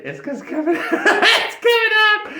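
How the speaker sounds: high squeal of laughter through the words; laughing so hard the voice jumps up. Real, not acted. Laughing while telling the flood.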